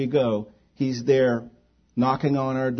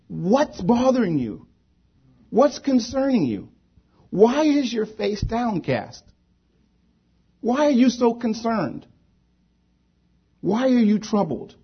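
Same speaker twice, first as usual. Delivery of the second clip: concerned questioning; 10 semitones higher than usual. Retold or imitated. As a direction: preach each question high, as God probing his children. Preacher voices God questioning his children.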